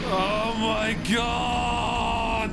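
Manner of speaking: long drawn-out exasperated yell; frustrated shout. Real, not acted. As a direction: stretch it out in despair. Boss fight goes badly, exasperated.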